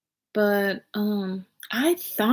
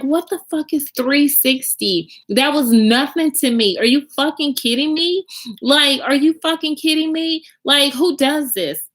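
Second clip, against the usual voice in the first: higher, exasperated venting; real outrage; 6 semitones higher than usual. Real, not acted. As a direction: push the indignation up in pitch. Venting outrage at boyfriend's fraud claim.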